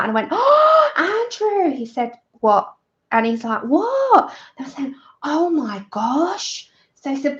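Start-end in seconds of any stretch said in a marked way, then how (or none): 0.3-1.8 high, gasped call for help; retold panicked call. Calling Andrew over about her tooth, mock panic. 3.6-4.3 high, drawn-out mock astonishment; retold startled what. Imitating partner reacting to her call, astonished. Retells partner's second, bigger reply. 5.2-6.7 slow, higher, rising dismay; retold shock. Re-enacting finding broken tooth for viewers, aghast.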